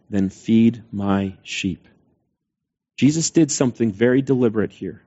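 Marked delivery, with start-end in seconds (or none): 0.1-1.9 slow solemn command. Acted. Jesus's solemn final command.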